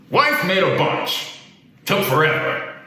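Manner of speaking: terse, put-on old man voice; cranky old man. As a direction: grumble it like a grumpy old-timer.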